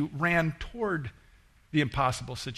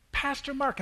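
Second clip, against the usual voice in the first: a much higher, eager call of his name; excited call; 11 semitones higher than usual. Retold or imitated. Woman running up to her pastor, excited high call. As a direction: call out to him thrilled. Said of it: "She was so bright and excited".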